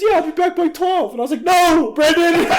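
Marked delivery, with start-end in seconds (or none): 0.0-1.1 high-pitched imitation of a casual friend; mock breezy reply in a high voice; oblivious friend. Voices same friend on phone. 1.4-2.5 high, loud cry into the phone; loud retold exasperation breaking into laughter; exasperated protest.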